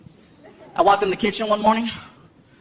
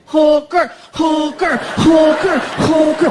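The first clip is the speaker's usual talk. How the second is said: high, loud rhythmic chant; child throwing a fit; 6 semitones higher, 4 dB louder, much slower than usual. Acted. Son throws a fit in the kitchen, loud chant. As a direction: chant it like a tantruming kid. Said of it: "He throws a fit in the kitchen".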